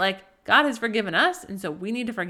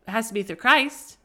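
ordinary talk

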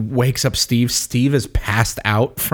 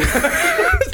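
high squealing laugh. Real, not acted. Reliving the Mjolnir moment.

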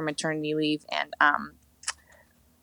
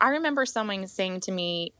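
ordinary talk

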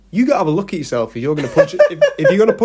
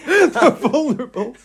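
laughing; 8 semitones higher, 3 dB louder, slower than usual. Real, not acted. Laughing at the joke.

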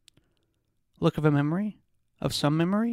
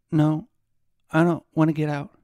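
louder, forced-out answer; louder frightened reply; a little louder; 5 dB louder than usual. A narrator's reading. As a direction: push the words out so he can hear. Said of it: "She was a little louder".

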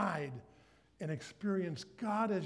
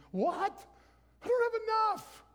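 put-on much higher, louder old widow voice; widow lady; 5 dB louder than usual. Preacher voicing the widow to the congregation, high and panicked. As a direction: squeal it like a fretful widow. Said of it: "the widow lady, like".

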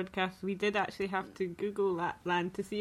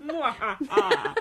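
slow, loud hammed-up cackle; laughing villain cackle; mock evil laugh; 5 semitones higher, 5 dB louder, slower than usual. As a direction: join in as the villain. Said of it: "add an evil laugh afterwards".

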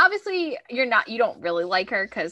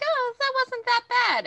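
high, light mock relief; 13 semitones higher than usual. Podcaster mimicking her own relief, high and light. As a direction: squeak it out like it's no big deal. Mocking own changing opinion.

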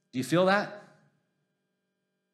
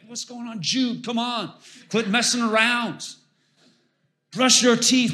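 mock-exasperated scolding, pitched up; a dad losing patience with a slow kid at bedtime; frustrated parent; 8 semitones higher than usual. Dad hurrying his son at bedtime, exasperated scold. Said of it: "I'm just getting frustrated".

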